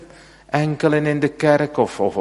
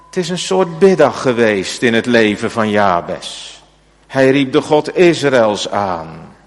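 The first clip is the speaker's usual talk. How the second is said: louder, raised preaching voice; fervent preaching; 5 semitones higher, 6 dB louder than usual. Acted. Preacher explaining Jabes' special prayer to the congregation, raised fervent preaching. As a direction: press the weight of Jabes' prayer on the church.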